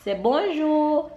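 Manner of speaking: C'est bonjour slow and high in French; drawn out bonjour to teach the child. Shown as a demonstration. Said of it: "teaching toddler French greeting".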